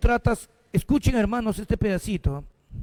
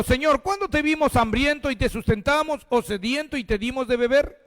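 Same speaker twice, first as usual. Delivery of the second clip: higher, fervent reading voice; preaching scripture; 7 semitones higher than usual. Acted. Pastor reading Matthew to the congregation, fervent and raised. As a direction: proclaim each line of the verse.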